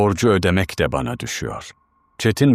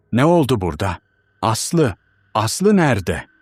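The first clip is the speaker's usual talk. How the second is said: raised pitch, urgent questions; alarmed shouting; 5 semitones higher than usual. A narrator's reading. Husband asks his teacher where Aslı is, urgently alarmed. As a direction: demand answers in contained alarm. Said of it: "diye bağırdım".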